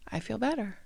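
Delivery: quietly relieved, lightly acted; a little voice, done crying; imaginary friend after crying. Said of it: "keeps crying and then stops and goes".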